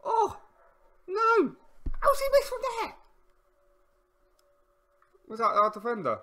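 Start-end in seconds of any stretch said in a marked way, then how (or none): 0.0-3.0 exasperated yell. Real, not acted. Near miss in the match.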